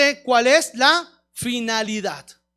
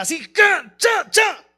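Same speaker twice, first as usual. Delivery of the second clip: shouted rope sounds; 5 semitones higher, 4 dB louder, slower than usual. Retold or imitated. Tightening the rope, sound effect.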